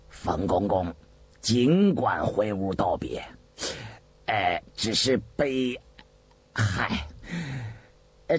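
low, hesitant, deferential official; ingratiating. A narrator's reading. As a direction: hedge politely to please a feared superior. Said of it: "竟一哈腰讨好地说". (哈腰讨好，吞吞吐吐)

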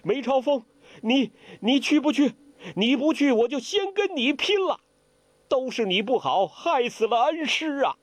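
high, grief-stricken shouting; a grieving disciple shouts for revenge; tearful shout. Acted. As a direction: rage at them through tears. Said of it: "大声叫道".

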